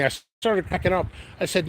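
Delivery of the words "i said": normal talking voice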